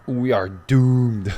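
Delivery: slow, loud mock-dramatic doom. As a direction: announce our doom like a melodrama. Mock doom about the game.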